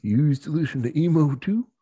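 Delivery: lightly put-on Western cowboy accent; a touch of Western accent. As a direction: greet them in a mild mock Western twang. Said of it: "Just do Western accents".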